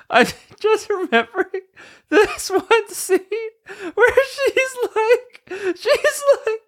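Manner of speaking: high-pitched helpless laughter; can barely get the words out through laughing; laughing hard. Real, not acted. Cracking up at a memory.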